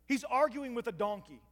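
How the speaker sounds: ordinary delivery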